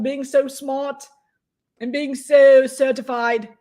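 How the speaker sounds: high, smug mock voice bragging about credentials; mock pompous troll. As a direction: parody a self-important fake expert. Mocking troll's claimed credentials.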